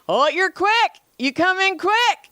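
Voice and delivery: high, silly put-on man's voice; mock creepy client. Naked client greets masseuse, high mock voice.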